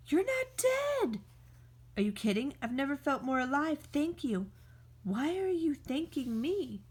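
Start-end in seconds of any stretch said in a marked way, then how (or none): 0.1-1.2 much higher, astonished; high, relieved amazement; amazed. Acted. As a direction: burst out in happy disbelief. Friend thought dead revives.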